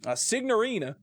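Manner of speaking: badly faked Italian accent; hammed-up Italian accent; Brad Pitt. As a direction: do a clumsy American attempt at Italian. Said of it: "sound like Brad Pitt trying to do an Italian accent".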